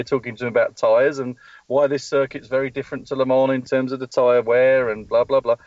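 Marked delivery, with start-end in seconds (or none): none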